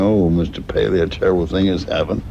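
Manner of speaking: ordinary talk, nothing marked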